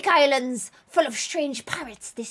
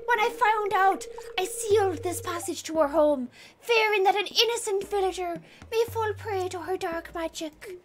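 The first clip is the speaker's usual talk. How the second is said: put-on Irish accent; 2 semitones higher than usual. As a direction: hush it in an Irish lilt. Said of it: "they're always Irish".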